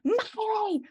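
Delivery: high-pitched drawn-out mock wail; shrill-pitched imitation of a whiny child's scream; screaming kid. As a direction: scream like a spicy kid left all alone. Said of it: "is just screaming".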